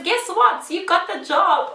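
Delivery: high, loud good-news announcement; imitating excited recruiter. Recruiter phoning with the job offer, excited. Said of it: "And she says".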